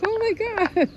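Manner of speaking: high, airy gasp of surprise; mock surprise. Realizing the runner had my dropped flower, surprised high. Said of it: "And I was like".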